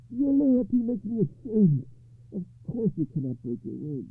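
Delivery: a king humbled by loyalty; ashamed king. A narrator's reading. Arthur answers Gawain.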